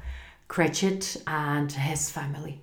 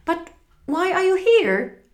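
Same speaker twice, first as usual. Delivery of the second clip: frightened; 11 semitones higher, 4 dB louder than usual. Acted. Scrooge afraid of ghost.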